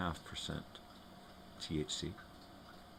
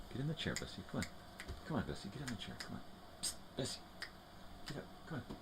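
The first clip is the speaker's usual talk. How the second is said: coaxing the dog; 7 semitones higher, 9 dB quieter, much faster than usual. Real, not acted.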